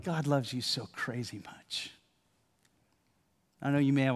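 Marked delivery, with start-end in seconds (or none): 0.0-1.9 hushed and close, like into an ear; tender soft words for the listener; soft whisper. Pretend. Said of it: "would love to be able to whisper in your ear".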